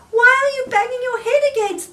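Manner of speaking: a small frog asking his friend in alarm; high Frog voice. Acted. Frog voiced in read-aloud story.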